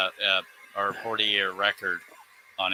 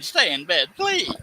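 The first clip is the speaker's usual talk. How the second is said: pleading whine; 7 semitones higher than usual. Retold or imitated. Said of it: "my body's saying".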